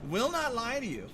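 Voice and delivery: high, loud insistence; exaggerated high-pitched vow; mock-emphatic. Real, not acted. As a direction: swoop up high and hold it. Emphatic repeat after laughing.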